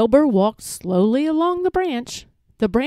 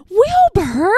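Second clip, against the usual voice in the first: high, loud astonished cry; gasped in surprise; 10 semitones higher, 5 dB louder than usual. A narrator's reading. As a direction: exclaim the name in amazement. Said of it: "gasped Elmer".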